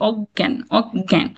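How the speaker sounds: slow অজ্ঞান split as "og-gyan"; doubled g. Shown as a demonstration. Teacher recapping the rule, double g in অজ্ঞান. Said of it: "এরকম double একটা উচ্চারণ".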